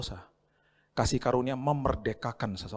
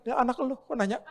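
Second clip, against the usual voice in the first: put-on higher, puzzled kid talking back; cheeky child; 12 semitones higher than usual. Confused child answering the angry parent, high and cheeky.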